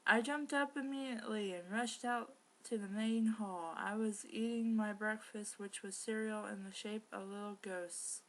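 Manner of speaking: usual speaking voice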